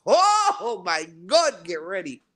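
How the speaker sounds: normal talking voice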